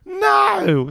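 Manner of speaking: loud, very high protest; incredulous outcry. Real, not acted. Objecting to South African at number two, incredulous. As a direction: cry out in disbelief at the ranking.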